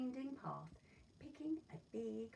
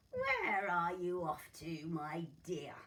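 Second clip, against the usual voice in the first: sly wolf; 7 dB louder, slower than usual. Acted. Wolf questions the girl.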